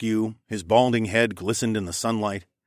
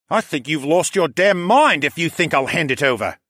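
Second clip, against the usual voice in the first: higher, louder furious snarl; 6 semitones higher, 5 dB louder than usual. Acted. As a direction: snarl back at the rival. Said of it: "Callan snarled".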